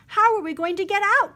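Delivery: scared of being trapped; cried in worry. A narrator's reading. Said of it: "cried Socks".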